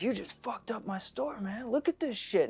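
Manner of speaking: high-pitched, aggrieved complaint; mock outrage, voice pushed up high; retold angry clerk. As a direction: imitate an irate shopkeeper scolding a customer. Acting out clerk accusing him of mess.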